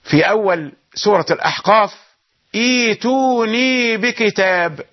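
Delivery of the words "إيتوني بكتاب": إيتوني opened with a long smooth ee; slow, measured إيتوني with no second glottal catch; eased second hamza. Shown as a demonstration. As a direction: draw out the first vowel of إيتوني. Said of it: "إذا بدأت بالكلمة تقرأ".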